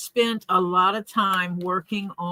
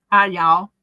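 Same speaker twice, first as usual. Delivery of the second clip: louder, playful Southern hi, y'all on request; hammed-up Southern accent; 3 semitones lower, 5 dB louder than usual. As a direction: ham up the Texas greeting with a laugh. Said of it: "Do it again".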